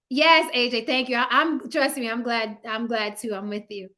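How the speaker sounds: ordinary talk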